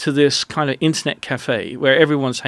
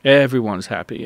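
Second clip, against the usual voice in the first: ordinary talk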